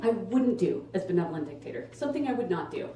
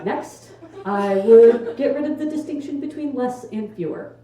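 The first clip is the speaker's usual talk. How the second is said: ordinary delivery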